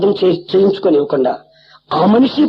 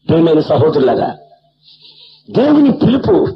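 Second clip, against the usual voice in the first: raised, emphatic sermon delivery; preaching; 6 semitones higher than usual. Acted. Pastor addressing the congregation after the story, emphatic preaching. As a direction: exhort the brethren from the pulpit.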